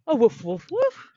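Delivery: loud, high playful woofs; dog barking. Acted. Teacher barking as the story dog. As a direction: bark like a happy puppy.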